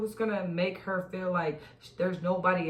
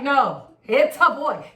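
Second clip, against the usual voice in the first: louder, higher, slow and firm; mock doctor insisting; 6 semitones higher, 11 dB louder, slower than usual. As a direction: spell it out slowly for her. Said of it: "They're like".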